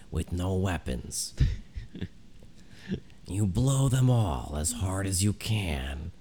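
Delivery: slow, drawn-out dramatic narrator voice. Acted. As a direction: lay it on slow like a history podcast. S0 continues the Dan Carlin parody.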